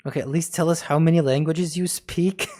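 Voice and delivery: ordinary talk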